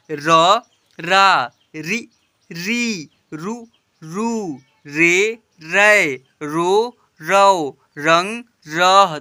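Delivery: slow, drawn-out chant of each syllable; syllable drill. Shown as a demonstration. Teacher reading the chart to children, slow drawn-out recitation. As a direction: recite each letter clearly for pupils to repeat.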